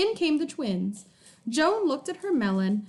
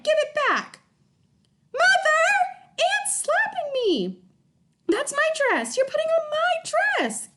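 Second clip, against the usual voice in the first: put-on very high, loud bickering children; children quarreling; 12 semitones higher, 4 dB louder than usual. A narrator's reading. Twins fighting over sock and dress, shrill squabble. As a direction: shriek like squabbling kids.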